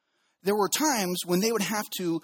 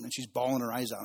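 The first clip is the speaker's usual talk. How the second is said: usual speaking voice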